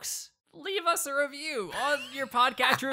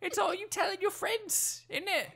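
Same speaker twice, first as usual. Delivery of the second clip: high mock cockney urchin; hammed-up cockney kid voice, much higher; terrible cockney accent; 8 semitones higher than usual. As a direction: sell the podcast like a cheeky London orphan. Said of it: "my terrible accent".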